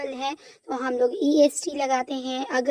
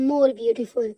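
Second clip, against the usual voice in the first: ordinary talk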